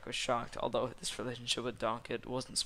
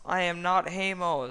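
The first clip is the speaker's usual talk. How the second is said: loud, high, theatrical protest; indignant denial; 7 semitones higher, 9 dB louder than usual. A narrator's reading. Farquaad denying it before a gasping crowd, loud indignation. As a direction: declare it with pompous outrage.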